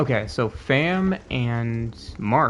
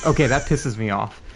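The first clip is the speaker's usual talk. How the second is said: ordinary delivery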